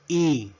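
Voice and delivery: letter E pronounced "ee"; English letter E. Shown as a demonstration. Teacher modelling the English alphabet, E as "ee". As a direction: say E as a long English ee. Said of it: "La letra E".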